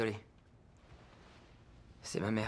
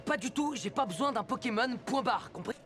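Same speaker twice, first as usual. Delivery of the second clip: higher-pitched, insistent rebuff; exasperated refusal; 9 semitones higher than usual. Acted. Young man refuses a Pokémon's help, exasperated and insistent. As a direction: shut the offer down firmly.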